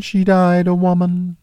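high, otherworldly spirit voice; ghost voice. Acted. Ghost recalling Scrooge's sister, high spirit voice. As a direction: speak as a gentle, distant ghost, pitched high.

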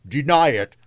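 high, emphatic ghostly cry; spirit crying out. A narrator's reading. The spirit challenges the city, crying out. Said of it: "cried the spirit".

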